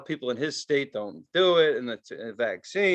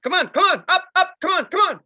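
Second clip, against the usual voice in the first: high, loud frontman hype chant; mocking a singer's high, loud come-on chant; hyping the crowd; 10 semitones higher, 7 dB louder than usual. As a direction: pump the crowd up like a rock singer begging for energy. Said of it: "he does this between every song".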